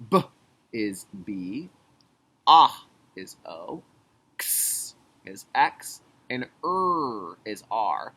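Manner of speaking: slow "Ah is O", "X is X" with each sound stretched; slow phonics, sound then letter. Shown as a demonstration. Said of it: "hear the sounds and write what I hear".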